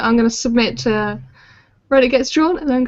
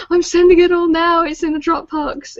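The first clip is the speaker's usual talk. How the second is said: higher, mock-urgent announcement; high, louder start, then easing off; mildly frantic; 7 semitones higher, 3 dB louder than usual. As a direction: lightly act out a deadline rush. Acts out message to waiting printers.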